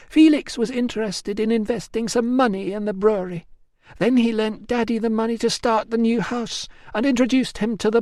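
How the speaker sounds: Emilia resigned. Acted. Emilia's account.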